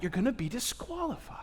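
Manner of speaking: hushed warning whisper; dramatic whisper. Acted. Preacher whispers a grave warning to the congregation. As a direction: breathe it like a secret threat.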